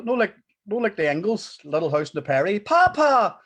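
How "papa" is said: put-on loud child calling out Papa. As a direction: call out like a little child. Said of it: "I always wanted the two wains to call me Papa".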